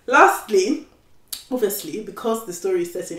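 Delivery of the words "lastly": ordinary delivery, nothing marked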